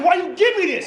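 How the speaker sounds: high, loud indignant question; indignant shout. Acted. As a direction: demand an answer at a shout. Acted argument with the doctor.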